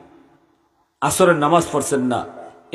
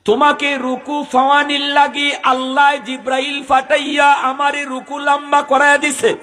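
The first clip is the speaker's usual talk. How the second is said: high sustained sermon chant, long-held words; half-sung, ringing proclamation; full-voiced melodic preaching; 12 semitones higher, slower than usual. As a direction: chant it out high over the congregation. Chants the Prophet's reply.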